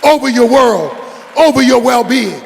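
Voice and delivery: forceful preaching. Acted. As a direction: preach it hard to the congregation.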